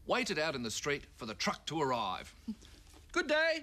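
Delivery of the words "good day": high squeaky little toy greeting; high squeaky good day. Acted. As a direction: chirp it like a tiny toy.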